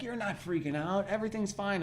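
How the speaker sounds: soft, hushed calming voice; soothing reassurance. Retold or imitated. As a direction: settle a panicking friend gently. Calming a panicking friend.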